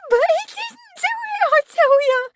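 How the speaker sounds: very high, loud, frantic protest; desperate maid crying out in defence; hysterical cry. A narrator's reading. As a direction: insist through hysterical tears. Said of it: "Meg cried hysterically".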